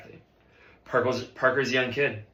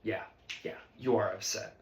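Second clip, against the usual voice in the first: ordinary talk, nothing marked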